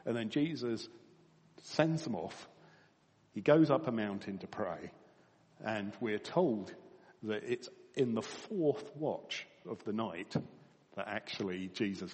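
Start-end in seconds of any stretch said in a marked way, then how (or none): none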